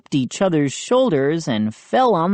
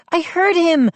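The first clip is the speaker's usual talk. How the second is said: high-pitched, excited exclamation; crying out; 13 semitones higher, 4 dB louder than usual. A narrator's reading. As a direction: burst out in a very high voice. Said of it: "Mindy cried".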